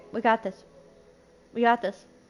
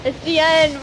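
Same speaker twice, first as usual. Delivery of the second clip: louder, higher mock-tragic wail; despairing cry; 3 semitones higher, 6 dB louder than usual. Real, not acted. Player losing hope on the pulls, loud despair. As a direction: cry out that all is lost.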